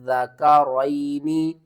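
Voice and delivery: slow, measured tajweed with long held vowels; sustained, level-toned Arabic recitation; Quran recitation. Shown as a demonstration. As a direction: recite it steadily, drawing out each madd. Teacher recites the verse slowly.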